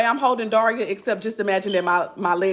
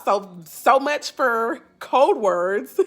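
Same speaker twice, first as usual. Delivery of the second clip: wry aside; much slower than usual. Real, not acted. Mother spotted on the live.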